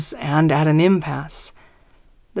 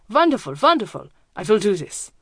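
put-on German accent; 3 semitones higher than usual. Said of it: "His accent is thick, German".

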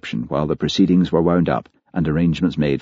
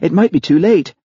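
louder and higher, rousing him urgently; urgent wake-up call, higher and louder; urgent shout; 11 semitones higher, 4 dB louder than usual. A narrator's reading. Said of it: "shouted Elspeth".

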